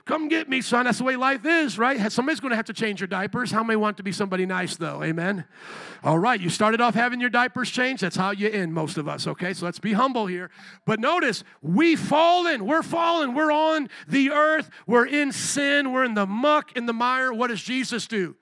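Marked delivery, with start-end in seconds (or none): 0.1-0.8 aged father calling. Retold or imitated. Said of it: "have it for my dad". 11.6-17.3 higher, louder, slow punched preaching; fiery preaching. Acted. Preacher driving home the fall to the congregation, high loud preaching.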